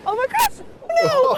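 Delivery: high startled cry; alarmed yelp as the sled goes; surprised. Real, not acted. Losing control on the sled.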